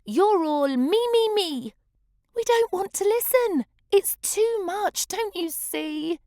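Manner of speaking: high, loud exasperated complaint; exasperated geckos. Acted. Other geckos scold show-off Goldie, high exasperated complaint. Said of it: "they implored".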